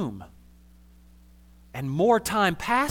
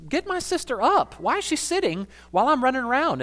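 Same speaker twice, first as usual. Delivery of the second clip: indignant Martha; 9 semitones higher than usual. Retold or imitated. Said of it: "she says to Jesus".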